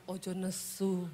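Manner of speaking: low, soft and slow plea; calm her down slowly and softly; husband gently asking wife not to be angry, softly; soft Javanese husband. Retold or imitated. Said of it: "Dia jawab".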